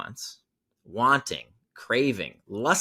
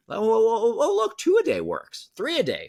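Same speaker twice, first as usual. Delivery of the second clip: hammed-up giddy oh oh, much higher; mocking Groupon execs thrilled by more sends, giddy; mock eager excitement; 10 semitones higher, 4 dB louder than usual. Mocking Groupon's growing greed.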